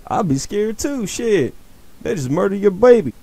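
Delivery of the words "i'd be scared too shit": ordinary delivery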